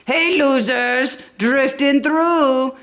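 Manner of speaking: lightly voiced cocky racer; taunting race car. A narrator's reading. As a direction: tease them as you zoom past. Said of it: "yelled a fast car".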